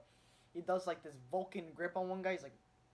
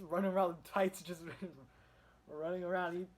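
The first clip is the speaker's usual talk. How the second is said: laughing through the words; 3 semitones higher, 7 dB louder than usual. Real, not acted. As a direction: chuckle while saying it. Laughing at tights-clad Batman.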